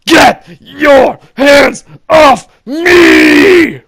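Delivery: screaming at the top of the lungs; full-throated furious roar; roaring yell. Retold or imitated. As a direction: roar it to assert yourself. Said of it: "with a roar so loud".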